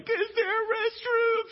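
very high, slow, stretched panicked squeal; hammed-up panicked shriek; panicky squeak. Shown as a demonstration. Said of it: "that's how I would sound".

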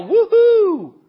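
high, loud cheering woohoo; woohoo yell. Shown as a demonstration. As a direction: whoop it out like a cheer. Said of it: "Can you yell".

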